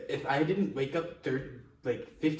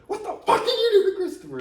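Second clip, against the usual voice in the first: high-pitched, shouted mock fury; imitating a loud, horrified telling-off; angry accusation; 8 dB louder, faster than usual. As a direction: shriek it like an outraged accuser. Retold furious accusation after the accident.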